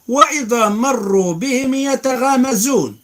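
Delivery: high, loud chanted recitation; Quran recitation. Acted. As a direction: lift the verse in full melodic chant. Recites verse, transliterated.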